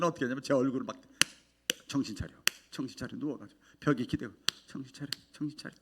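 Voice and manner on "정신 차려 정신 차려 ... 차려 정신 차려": self-admonishing. Retold or imitated. Telling himself to snap out.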